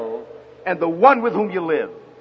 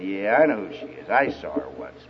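low, soft deadpan reply; unimpressed dad; 11 semitones lower, 6 dB quieter than usual. Retold or imitated. Dad answering his smitten son, dryly unimpressed. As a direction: drop right down, deflating his enthusiasm.

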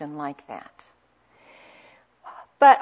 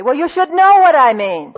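higher and much louder, exasperated; indignant snap; 8 semitones higher, 11 dB louder than usual. Retold or imitated. Spouse retorting to partner's question, loud and indignant. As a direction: fire it back as if it's obvious. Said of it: "heard wives or husbands say".

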